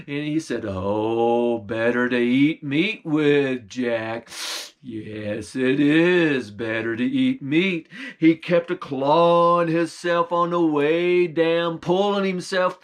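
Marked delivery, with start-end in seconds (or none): 0.7-7.9 low, slow, menacing bear. Acted. Bear sniffing out the stolen meat, low and menacing. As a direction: rumble it like a hungry bear. Bear voiced in Jack tale.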